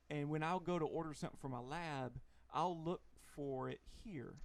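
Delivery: usual speaking voice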